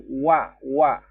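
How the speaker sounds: wa pressed firmly with the lips; rounded wa. Shown as a demonstration. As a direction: push the lips forward on wa. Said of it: "Wa-nya kurang ditekan di bagian bibir". (wa ditekan di bibir)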